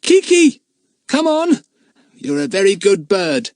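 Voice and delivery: high, loud call. Acted. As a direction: call the bird from afar. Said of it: "he called Kiki".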